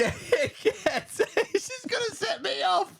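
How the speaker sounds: high, very slow, crying and laughing; laughing through tears. Real, not acted. Warning her co-host the story gets sadder, crying laugh. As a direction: squeeze the words out through tears. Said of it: "[name], do you need a minute?".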